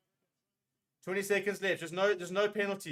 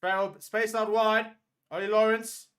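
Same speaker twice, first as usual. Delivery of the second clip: louder, higher, yelling the play; urgent shout; 6 semitones higher, 7 dB louder than usual. Real, not acted. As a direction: call out the space like a frantic fan. Urging players from the sofa.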